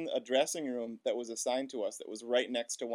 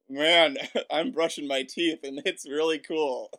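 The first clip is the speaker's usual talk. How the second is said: loud, laughing fake-casual line; 4 semitones higher, 5 dB louder, slower than usual. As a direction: hide embarrassment with a laugh. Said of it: "I actually said".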